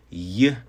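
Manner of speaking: "y" said as an isolated English Y glide; English Y sound. Shown as a demonstration. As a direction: sound out "y" as a short consonant, as in English. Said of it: "pronounced just as it is in English".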